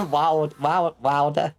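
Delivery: mock New Jersey "water", drawn-out vowel, no R; New Jersey accent. Streamer teased about their accent, trying mock New Jersey. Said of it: "Your New Jersey ass saying".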